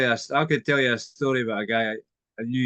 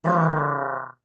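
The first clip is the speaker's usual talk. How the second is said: engine sputter noise; 3 semitones higher, 3 dB louder than usual. Retold or imitated. Imitating a failing engine.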